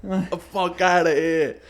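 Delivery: dwarf yelling New York style; New York accent. Retold or imitated. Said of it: "This fucking little dwarf".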